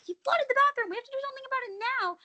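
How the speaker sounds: high, rushed mock panic; panicked retelling. Retelling the flood to Naeem, high mock panic. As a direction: squeak it out in alarm, fast. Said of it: "I was like".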